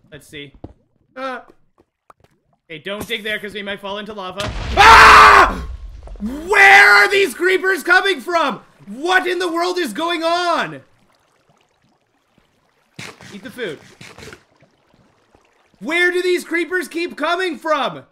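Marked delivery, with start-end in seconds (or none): 4.8-5.7 sudden high shriek of fright; startled scream. Real, not acted. Gamer startled by a creeper, shrieking. 6.2-17.2 high, loud and slowed, shouting in alarm. Real, not acted. Panicked player yelling at the creepers. Creepers keep attacking him.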